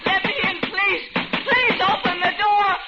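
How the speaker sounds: panicked cries for rescue; desperate shouts. Acted. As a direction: scream at the door to be let in. Fleeing woman bangs on door.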